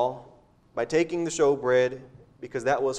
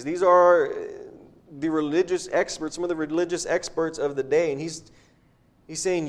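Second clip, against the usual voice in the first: normal talking voice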